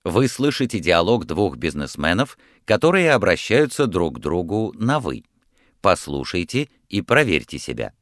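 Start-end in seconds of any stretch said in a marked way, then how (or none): none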